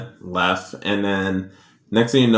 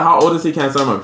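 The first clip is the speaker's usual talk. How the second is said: pitched up, pushy and eager; eager mock question; 5 semitones higher, faster than usual. A nosy customer pressing the proud mom, pitched up and eager. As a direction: pitch it up like she can't wait to know. Lady retold asking eagerly.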